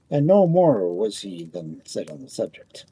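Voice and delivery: ordinary delivery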